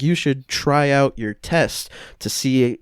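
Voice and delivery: ordinary talk, nothing marked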